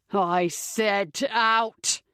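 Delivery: a very slow, drawn-out bellow; a mild read of a bellow, very slow. A narrator's reading. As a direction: stretch every word out in rage. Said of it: "he bellows".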